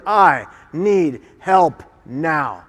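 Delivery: very slow, each word set apart; deliberate plea. Retold or imitated. Survivor showing how he asks people for help, slow and deliberate. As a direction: give every word its own weight. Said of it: "four simple but effective words".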